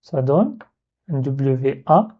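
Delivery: ordinary delivery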